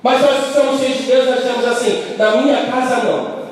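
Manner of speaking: pregação forte e alta, voz erguida; pregação inflamada. Acted. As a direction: clamando à igreja com fervor. Preaching climax to congregation. (pastor pregando com força à congregação)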